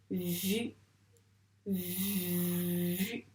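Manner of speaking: "vu" softly, with a long-held v; slow, careful dictation of "vu"; dictated syllable. Shown as a demonstration. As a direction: stretch the v of "vu" before landing on the vowel.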